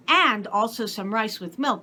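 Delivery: plain reading voice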